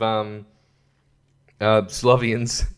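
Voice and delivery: ordinary delivery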